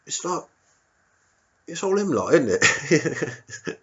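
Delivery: a bigoted local finally letting it slip; sly East End bigot voice; cagey local. Retold or imitated. Local finally reveals prejudice.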